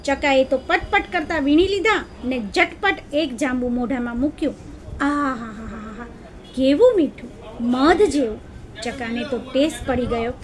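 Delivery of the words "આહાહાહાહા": slow low relishing aaah; mmm of delight. A narrator's reading. Sparrow tasting a sweet berry, relishing aaah. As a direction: savour it with a long aaah.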